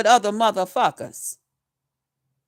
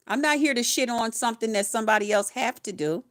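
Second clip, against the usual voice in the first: usual speaking voice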